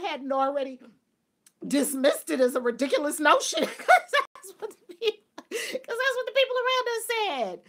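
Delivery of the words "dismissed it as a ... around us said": high, loud point made through laughter; laughing. Real, not acted. Speaker explaining dismissed riches, laughing. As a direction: keep teaching while cracking up.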